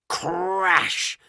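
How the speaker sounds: usual speaking voice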